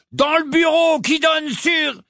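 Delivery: high, drawn-out holler giving a location; yelling. A narrator's reading. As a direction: call it out slowly to far-off allies. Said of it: "hurla le mange-mort". (hurlement aigu, traînant)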